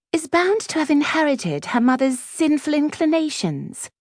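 high, prim disapproval; haughty schoolgirl, pitched well up; snobbish Clementine. A narrator's reading. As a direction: deliver the insult in a lofty high voice. Clementine continues her insult.

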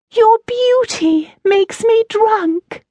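slow, very high mock swoon; drawn-out squeaky parody of a lover; hooting falsetto. A narrator's reading. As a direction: hoot the love line mockingly. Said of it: "Oakenrot hooted".

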